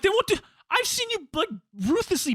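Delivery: high incredulous echo of They won't do. Retold or imitated. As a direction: repeat her words in disbelief.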